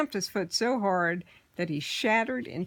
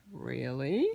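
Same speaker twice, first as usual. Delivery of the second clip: lightly voiced sly goblin; 5 semitones lower, 3 dB quieter than usual. Horned goblin interested.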